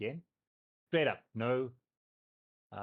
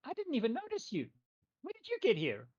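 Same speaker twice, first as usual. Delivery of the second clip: very high, surprised little creature; small spirit noticing strangers, pitched way up; high creature voice, taken aback; startled tree spirit; 12 semitones higher than usual. Acted. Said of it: "he kind of gets, like".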